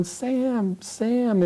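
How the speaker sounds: high, softened imitation of calling out; mock distant yell. President calling over to a reporter, mock yell. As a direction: call a name out high without real volume. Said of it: "he's yelling to Sam Donaldson".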